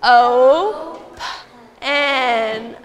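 each letter of "O, P, N" said separately with pauses; teacher sounding out open for the class. Shown as a demonstration. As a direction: spell it out slowly, letter by letter. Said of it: "let's sound this out".

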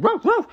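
high, loud puppy woofs; yelped double woof, very high; imitated dog bark. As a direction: bark like an intervening pup. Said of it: "He walked over and said".

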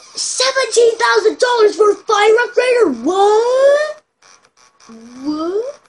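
high, loud disbelieving shout; shocked shout. Real, not acted. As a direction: yell it in disbelief. Outraged at game price.

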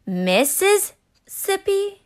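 high, slow, drawn-out pun; punny proposal. Acted. Nevada proposing to Mississippi, high and slow.

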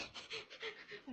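high, quiet giggle; short burst of high, soft laughter. Real, not acted. Streamer amused by her viewers, giggling.

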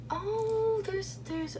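a high, sudden startled oh; startled oh at a chipmunk; surprised. Real, not acted. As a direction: jump up in pitch, caught off guard. Spots an animal in room.

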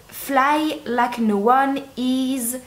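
put-on slow, careful English-accented reading of the slogan. Reading the sock slogan aloud to viewers, slow English accent. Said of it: "Est-ce que mon accent est formidable?".

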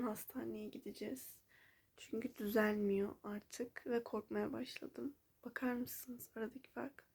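soft worried whisper. Real, not acted. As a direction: whisper the question. Quiet night talk about eye.

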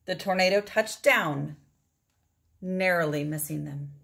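plain reading voice

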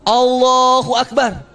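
slow, drawn-out "Allahu Akbar"; imitated desperate cry. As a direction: stretch it like a mock panicked prayer. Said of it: "sebelah saya bilang apa?".

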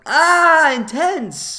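high, loud sarcastic gasp; a loud high mock gasp of excitement. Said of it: "I'm like".

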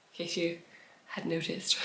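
a conspiratorial whispered aside to listeners. Real, not acted. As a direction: drop to a sly whisper. Cheeky aside about finished objects.

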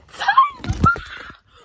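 high shouted gasp with laugh; loud gasp. Real, not acted. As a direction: gasp out in delighted shock. Sees gift, gasps loudly.

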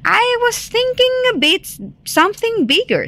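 high, fast, put-on wolf; hammy wolf voice. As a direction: rush it in a silly high voice.

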